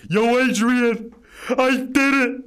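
hammed-up boxer shouting his win; Rocky impression. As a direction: belt it out like Stallone calling for Adrian.

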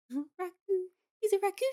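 high squeaky excited voice. Real, not acted. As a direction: squeal it with a laugh. Amused squeal about the raccoon.

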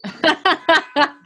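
bright burst of laughter, falling off; amused laugh at the website punchline; real laugh. Real, not acted. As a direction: laugh out at the joke. Host laughs at website joke.

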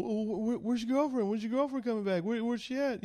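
pry like a couple fishing for a third; nosy couple. Retold or imitated. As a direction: swinger couple asking about the girlfriend, pitched high. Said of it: "They kept going".

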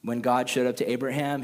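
ordinary delivery

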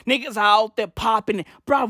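usual speaking voice

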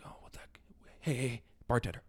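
hushed, sneaky aside at the bar; secret whisper. Pretend. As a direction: whisper so nobody hears you. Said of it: "Are you, like, secretly".

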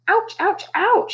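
high yelping ouch; girl yelping on a rough ladder; yelping ouches. A narrator's reading. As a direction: yelp with each step down. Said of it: "she yelped".

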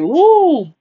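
high, loud, swooping woo; startled woo. Retold or imitated. Woman hearing a man claim her as his, exaggerated surprise. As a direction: let out a big exaggerated woo. Acted reaction to being claimed.

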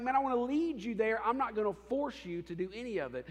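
keen, lifted voice leading the way; eager invitation. Acted. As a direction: call them along with excitement. Voicing Jesus inviting the listener.

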